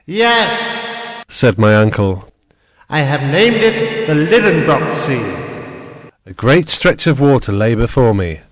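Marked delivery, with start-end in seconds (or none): 0.0-5.3 proud uncle. A narrator's reading. Proud uncle naming sea.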